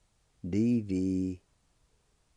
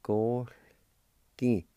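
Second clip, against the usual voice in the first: ordinary talk, nothing marked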